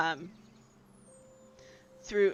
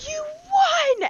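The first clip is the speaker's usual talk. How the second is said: put-on child's gasp, then a much higher, louder cry; excited gasp then shout; 8 dB louder than usual. Douglas gasping with joy at the win. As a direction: gasp and shout it with pure delight. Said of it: "And he goes".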